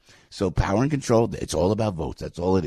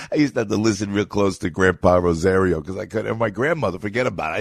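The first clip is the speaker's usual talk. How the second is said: ordinary talk, nothing marked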